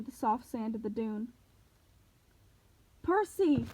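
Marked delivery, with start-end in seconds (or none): 3.0-3.6 high urgent yell. Acted. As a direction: call out in alarm. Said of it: "Annabeth yelled".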